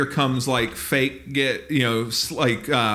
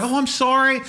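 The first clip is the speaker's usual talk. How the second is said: high-pitched hollow sorry; mock apology; 9 semitones higher, 3 dB louder than usual. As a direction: parody an owner's empty apology. Mimicking owner's hollow apology.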